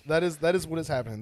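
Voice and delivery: fast and loud excited. Real, not acted. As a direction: burst out in excitement. Excited agreement.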